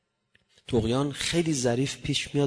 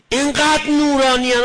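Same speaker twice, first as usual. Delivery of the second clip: much higher and much louder, swelling on انقدر; rapturous; 10 semitones higher, 10 dB louder than usual. Acted. Preacher exclaiming in awe at how radiant such people are. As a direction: burst out in awe.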